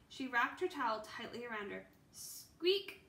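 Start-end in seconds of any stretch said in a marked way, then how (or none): 2.1-3.0 high shivery squeak; cold mouse squeak. A narrator's reading. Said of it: "Peanut sounded cold too".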